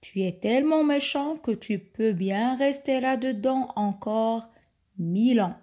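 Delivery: plain reading voice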